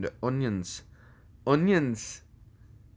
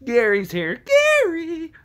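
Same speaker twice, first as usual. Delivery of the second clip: high cartoon call; 7 dB louder than usual. Calling out to Gary, high cartoonish call. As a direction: call out loudly, leaping way up.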